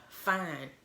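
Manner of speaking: loud, triumphant reveal; villain reveal. Retold or imitated. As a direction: throw the secret out boldly. Villain reveals himself to Mac.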